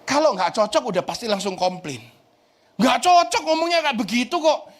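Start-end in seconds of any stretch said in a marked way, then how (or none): 2.7-4.7 family complaint. Retold or imitated. Family complaining about his preaching.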